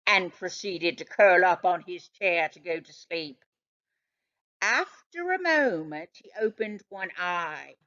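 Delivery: ordinary delivery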